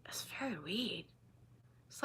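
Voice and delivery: soft unvoiced whisper; whispered aside. Real, not acted. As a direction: lean in and breathe it.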